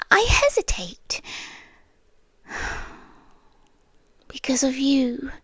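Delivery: hushed whisper, close to the ear; whispered confession. Acted. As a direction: confide it under your breath. Intimate confession to frightened listener.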